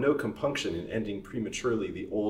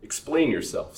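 louder, higher stern command; sternly; 6 semitones higher, 5 dB louder than usual. Acted. Master orders the servant to explain, stern. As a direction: order him to explain, firmly. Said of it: "said his master, sternly".

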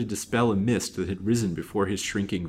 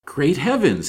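higher, louder startled outburst; shocked exclamation; 6 semitones higher, 6 dB louder, slower than usual. A narrator's reading. Detective thinks aloud on finding the blue dress, shocked exclamation.

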